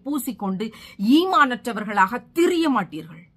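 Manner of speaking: ringing, emphatic sermon delivery; fervent exhortation; impassioned preaching. Real, not acted. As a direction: preach it forcefully, leaning on key words. Preaches at full force.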